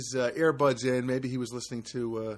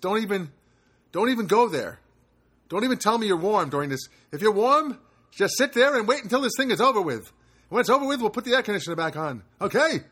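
loud, raised-pitch playful rant; mock scolding; 7 semitones higher, 6 dB louder than usual. Host warning listeners not to complain about warmth, mock scolding. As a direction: tell them off in mock exasperation.

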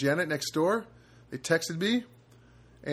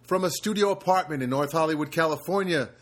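fifties voiceover guy. Acted. Podcast host opening the show as a fifties voiceover announcer. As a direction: open the show like a fifties movie narrator. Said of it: "Why am I talking like this? Like one of those fifties voiceover guys".

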